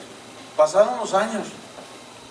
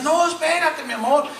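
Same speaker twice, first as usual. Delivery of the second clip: higher-pitched imitation of a husband begging; retold tearful pleading; 6 semitones higher than usual. Husband begging his wife not to leave, mock-tearful pleading. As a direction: plead urgently, mimicking a desperate man. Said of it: "él llorando le dice".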